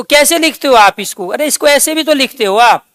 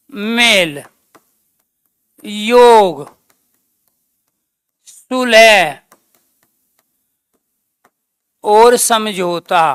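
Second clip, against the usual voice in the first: each word spaced out slowly for note-taking; slow dictation; 3 semitones lower, much slower than usual. Shown as a demonstration. Teacher dictating the meaning slowly to students. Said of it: "ये क्या है? शाब्दिक अर्थ".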